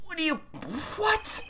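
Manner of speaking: very high, stretched explain; desperate plea. Real, not acted. Player to the game, high and desperate. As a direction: beg for an answer.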